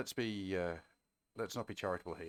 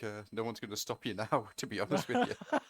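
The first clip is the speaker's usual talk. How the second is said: ordinary talk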